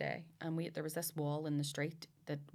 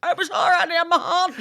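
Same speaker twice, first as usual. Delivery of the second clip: high, hammed-up defensive yell; loud protest; 12 semitones higher, 15 dB louder than usual. Wife defending her popcorn to husband, high mock protest. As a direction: protest loudly through laughter.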